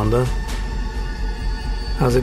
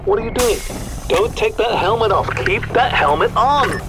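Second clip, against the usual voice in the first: high panicked pleading; 10 semitones higher than usual. Acted. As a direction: beg him urgently. Controller panics, shouting warnings.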